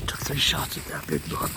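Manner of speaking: hushed hunting whisper. Real, not acted. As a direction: keep it low so the deer won't hear.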